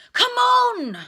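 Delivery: loud, high urging cry; urgent growl. Acted. Harry urging his broom in the rain, loud growl. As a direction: push the broom on, hard. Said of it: "he growled at his nimbus".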